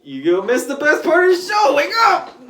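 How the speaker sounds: very high, loud mocking shout. Deadpool taunting Professor X, high shout. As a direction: scream it at him gleefully.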